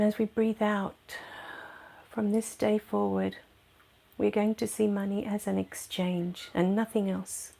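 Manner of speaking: soft, unhurried meditation voice with long pauses; guided meditation. Real, not acted. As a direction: guide them softly into letting go.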